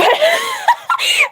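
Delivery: very high-pitched burst of laughing; real helpless laughter. Real, not acted. Streamer cracking up over a misunderstanding, helpless laughter.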